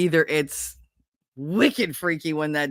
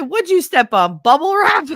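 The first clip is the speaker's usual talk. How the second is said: high, loud incredulous question; loud, high-pitched ribbing; mock astonishment; 9 semitones higher, 6 dB louder than usual. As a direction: jump up high in teasing disbelief. Son teasing her creaking joints.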